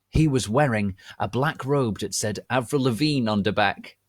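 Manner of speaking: usual speaking voice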